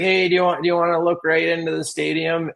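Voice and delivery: high, cheery pilot. Retold or imitated. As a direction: call it out like a chirpy pilot. Plane pilot invites a stadium look.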